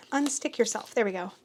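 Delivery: ordinary talk, nothing marked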